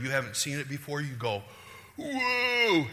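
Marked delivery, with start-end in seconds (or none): 2.0-2.9 high, loud whoop of discovery; excited whoa. Acted. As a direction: whoop as if truth just hit you. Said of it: "you are excited beyond measure".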